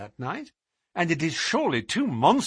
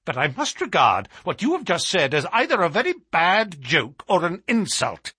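raised, indignant voice; angry; 5 semitones higher, 4 dB louder than usual. A narrator's reading. Colonel taking Holmes's words as an insult, indignant. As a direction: take offence, pitch climbing. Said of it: "The Colonel flushed angrily".

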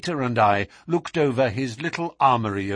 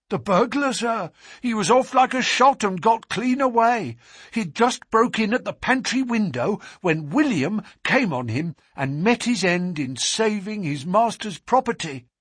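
high-pitched, excited servant voice; agitated butler; 10 semitones higher than usual. A narrator's reading. Butler telling his master who was killed, agitated. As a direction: report the killing in a high fluster.

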